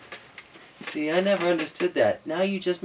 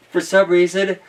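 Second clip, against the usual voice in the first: usual speaking voice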